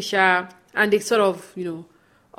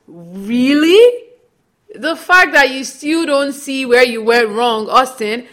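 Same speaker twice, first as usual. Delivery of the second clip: exasperated scolding; 4 semitones higher, 7 dB louder, much slower than usual. Real, not acted. Host scolding Austin directly.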